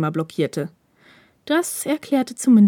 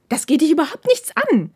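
higher, angry snarl; snapping back; 9 semitones higher, 4 dB louder than usual. Acted. Takeda snarls back at Hirakawa, angrily. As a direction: snap it back at him. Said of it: "fauchte Takeda zurück".

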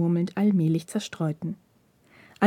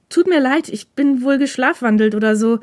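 hoch und lauter, gespielt verlegen; hastige, vorgeschobene Entschuldigung; 5 semitones higher, 5 dB louder than usual. Acted. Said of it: "sagte Takeda schnell und gab sich alle Mühe, einen verlegenen Eindruck zu machen". (gespielte Verlegenheit, höher und lauter)

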